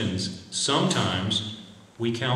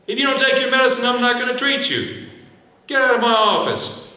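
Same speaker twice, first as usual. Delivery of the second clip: much higher and louder, scolding and impatient; harsh bullying doctor; 12 semitones higher, 5 dB louder than usual. Acted. Doctor scolding a noncompliant patient, mock angry shouting. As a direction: berate the patient like a fed-up doctor who threatens to drop her. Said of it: "You don't come at Missus Smith and say".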